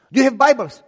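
loud, high demanding police voice; police shouting. Retold or imitated. Police officer shouting at father during home search. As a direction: bark it like an officer.

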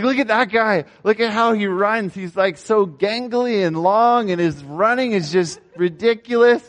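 high mocking jeers; mocking teammates. Teammates laughing at the film, mocking jeers. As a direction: poke fun at the gangly runner. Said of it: "They're like".

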